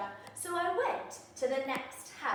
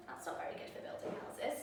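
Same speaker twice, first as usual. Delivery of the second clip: a hushed secret aside; whispered aside; 5 semitones lower, 11 dB quieter, much faster than usual. Acted. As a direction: whisper it confidentially. Aside to listener.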